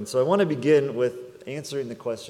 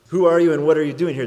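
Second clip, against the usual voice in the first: loud, fast, high proclamation; booming question; 5 semitones higher, 11 dB louder, faster than usual. Acted. Preacher to congregation, booming the big question. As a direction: boom it out to the whole room.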